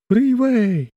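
high, slow insinuating voice; a thin eerie wheedle; thin wheedling voice. A narrator's reading.

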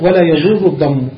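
ordinary delivery

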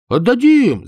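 высоко и громко, горячо обещая; убеди соседа, что заплатят; заверяя. A narrator's reading. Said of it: "заверял Чонкин". (горячее заверение)

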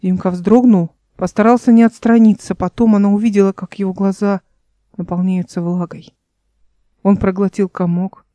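plain reading voice

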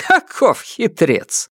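high, slow snorting scorn; scoffing. A narrator's reading. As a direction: scoff at the little schemer. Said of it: "Императрица фыркнула".

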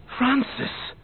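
high, loud cry of relief; joyful outcry. Acted. Leo realises the obstacle is only a child, joyful outcry. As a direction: burst out with her name in delight.

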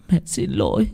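soft and tearful; a quiet, choked sorry; mother holding her child, softly tearful; tearful apology. Acted. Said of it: "khóc đẫm lệ".

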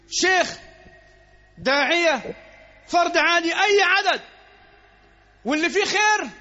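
fervent preaching. Acted. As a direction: drive the promise home with fire. Preacher building fervour. (الخطيب يطمئن المصلين بحماس وصوت عالٍ)

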